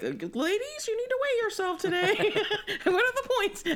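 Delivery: chirpy diet coach, laughing; Weight Watchers lady. Retold or imitated.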